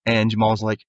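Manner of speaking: normal talking voice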